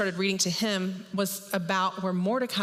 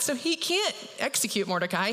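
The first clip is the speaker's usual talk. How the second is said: ordinary delivery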